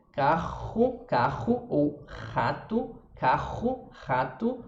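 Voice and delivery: "carro" and "rato" with a raspy R from the throat. Shown as a demonstration. Teacher showing the Portuguese throat R to students. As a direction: clear your throat on the R of "carro". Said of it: "o R na garganta".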